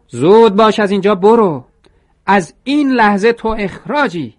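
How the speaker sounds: very high, very loud firing yell; furious shout. A narrator's reading. Mr. Sasaki fires Toro on the spot, screaming. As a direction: bellow him out of the shop. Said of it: "آقای ساساکی داد زد".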